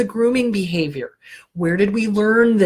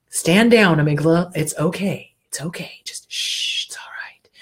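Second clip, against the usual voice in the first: fading down to a hushed whisper; calm it down until you are whispering; soothing the amygdala; 2 semitones lower than usual. Retold or imitated. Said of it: "you just acknowledge it".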